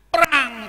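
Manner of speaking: bunyi pecah tinggi dan keras; tiruan gelas pecah. Retold or imitated. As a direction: tirukan dentang gelas jatuh. (tiruan bunyi prang yang nyaring)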